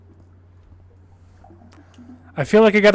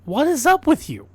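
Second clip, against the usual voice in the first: baffled; 7 semitones higher than usual. Real, not acted. Baffled at game character.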